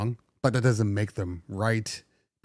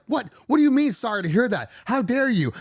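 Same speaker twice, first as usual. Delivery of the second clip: much higher, fast indignant outburst; outraged caller; 12 semitones higher, 4 dB louder than usual. Retold or imitated. Caller complaining to the insurance company, outraged. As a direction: blow up at the phone agent. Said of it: "you're like".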